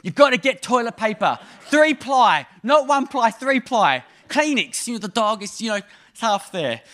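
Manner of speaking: put-on a kid rattling off his report, loud and high; excited kid. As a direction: shout the ply bit like it's obvious. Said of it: "He goes".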